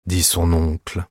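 ordinary delivery, nothing marked

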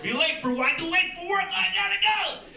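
high, loud, hammed-up family argument; American TV drama. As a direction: overact a frantic late-for-work scene. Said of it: "Everything on American T V is about work".

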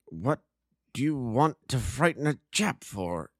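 lightly voiced befuddled opium smoker, drawn out; a hint of a muddled man waking from a stupor; dazed addict. Dazed addict slowly asks Watson the time, lightly voiced.

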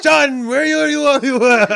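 loud, high, botched Jamaican shouting; hammed-up drunk Jamaican call, laughing; drunk Jamaican accent. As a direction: holler in a badly faked Jamaican accent. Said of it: "comes in all Jamaica drunk".

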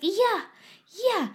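put-on high, eager toddler yelp; Amandine. Toddler asked about the bigger banana, high eager yes. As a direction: imitate a little child's emphatic high answer. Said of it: "She was like".

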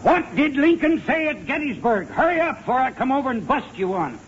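high, impatient demand for an answer; badgering him, pitched well up. Impatient repeat with a threat.

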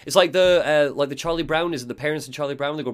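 plain reading voice

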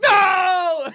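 long, high, loud wail; hammed-up scream of despair; mock anguished shout. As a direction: howl it out in mock agony. Acts out hearing her answer.

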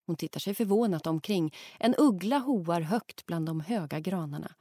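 ordinary delivery, nothing marked

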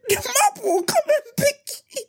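squeaked high through laughter; high squealing read; laughing. Retold or imitated. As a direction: laugh out the words. Laughing at reply.

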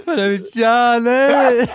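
slow, odd star voice; lightly voiced eccentric actor; a hint of a dreamy film star; Johnny Depp. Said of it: "kör Johnny Depp".

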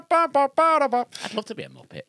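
slow high puppet voice; squeaky Muppet impression. Does a Muppet voice.

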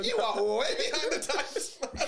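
high, incredulous ribbing through laughter; real amused mock scolding; laughing tease. Real, not acted. Teasing friend about finished show.

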